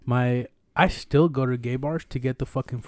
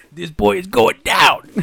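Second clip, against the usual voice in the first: loud, higher, stretched-out call; exclaimed, drawn out; 5 semitones higher, 8 dB louder than usual. Retold or imitated. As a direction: crow it slowly and loudly. Tells what the man thought, exclaimed.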